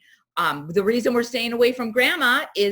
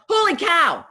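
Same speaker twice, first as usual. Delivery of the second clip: alarmed exclaim; 9 semitones higher, 5 dB louder than usual. Retold or imitated. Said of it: "And you're like".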